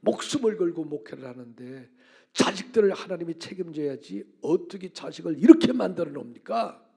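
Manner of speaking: loud, indignant protest retold from the pulpit; arguing with God. Pastor retelling how he argued with God, indignant. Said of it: "하나님께 내가 막 따졌어요".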